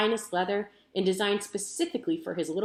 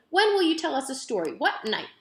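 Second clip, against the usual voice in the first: put-on insistent little girl; 6 semitones higher, 3 dB louder than usual. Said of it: "said Abilene".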